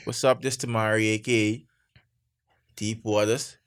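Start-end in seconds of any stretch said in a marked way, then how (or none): none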